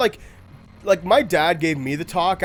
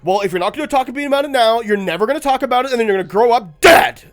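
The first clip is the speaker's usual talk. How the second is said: pushy parent; 6 semitones higher, 6 dB louder, faster than usual. Retold or imitated. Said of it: "it became a matter of, like".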